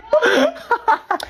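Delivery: high cackling laugh at sister; laughing cry; shrill mocking laugh. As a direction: laugh at her face, high.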